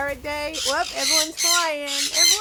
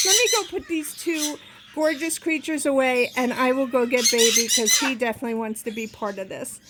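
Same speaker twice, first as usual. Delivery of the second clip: ordinary talk, nothing marked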